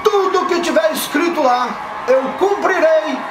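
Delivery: shouted politician addressing a crowd; campaign rally speech. Acted. Skit, candidate shouting to crowd.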